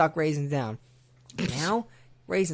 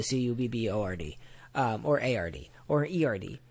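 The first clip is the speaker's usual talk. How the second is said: letter by letter; spelling it out; 3 semitones lower than usual. Shown as a demonstration. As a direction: spell it out one letter at a time. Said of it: "How do you spell that?".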